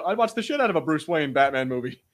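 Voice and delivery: ordinary delivery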